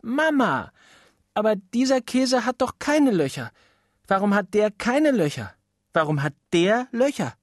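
put-on high little-boy voice, pestering; a small boy nagging his mother at the table; whiny child. Little boy pestering his mama at dinner, high child voice.

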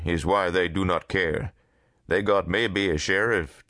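animated character voice in broken English; lively, emphatic broken-English speaker; Dupre. Acted. Dupre explains local law troubles to Harvey, animated.

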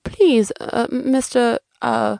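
hesitant, feeling for the name; flustered welcome. Acted. Host fumbling a visitor's name, flustered. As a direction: welcome him while groping for a name.

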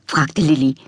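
plain reading voice

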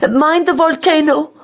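high, urgent queen voice; calling out. A narrator's reading. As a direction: call a warning at high pitch.

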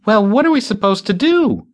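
high whiny moan. A narrator's reading. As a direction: moan it, voice pitched well up. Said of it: "Bitsy moaned".

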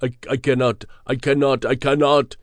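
ordinary delivery, nothing marked

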